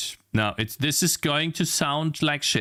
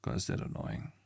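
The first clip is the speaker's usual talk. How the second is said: much softer, breathy grumble to himself; annoyed whisper; 12 dB quieter than usual. Real, not acted. Streamer muttering at the annoying software, whispered. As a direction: whisper it through exasperation.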